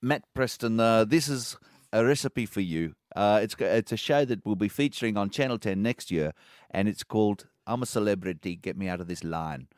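put-on South African accent. Contestant pitching a TV show to judge, South African accent. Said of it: "doing bad South African accents".

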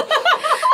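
high-pitched burst of laughter. Real, not acted. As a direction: let out a squealing laugh. Laughing at grandma's remark.

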